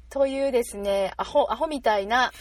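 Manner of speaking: usual speaking voice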